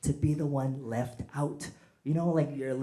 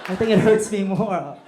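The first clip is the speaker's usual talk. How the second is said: put-on much louder, higher cry of a kid getting the belt; mock pained yell; 9 semitones higher, 11 dB louder than usual. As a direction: yell back through the pain, half joking. Kid being beaten with belt.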